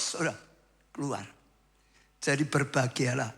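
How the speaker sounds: ordinary talk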